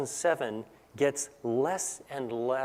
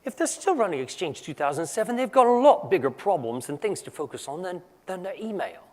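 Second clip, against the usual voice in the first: lightly put-on British accent, a bit higher; 5 semitones higher, 3 dB louder than usual. Quoting a British colleague's suggestion to the audience, in a modest British accent. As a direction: imitate a British colleague's clipped advice, amateur British accent. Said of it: "I'm gonna do my best British accent".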